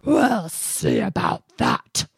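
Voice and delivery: slow, loud bear threat; menacing bear voice; growling bear. Acted. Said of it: "growled the bear".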